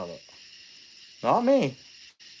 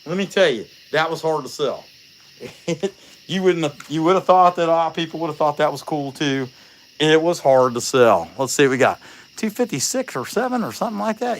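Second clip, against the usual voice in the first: normal talking voice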